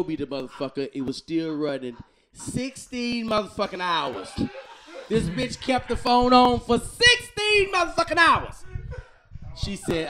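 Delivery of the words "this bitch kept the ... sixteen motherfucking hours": outraged. Real, not acted. Outraged retelling.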